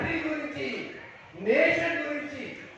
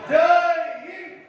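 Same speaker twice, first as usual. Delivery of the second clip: high, ringing call of Hind; slogan shout; 6 semitones higher, 5 dB louder than usual. Shown as a demonstration. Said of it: "గట్టిగా అనండి".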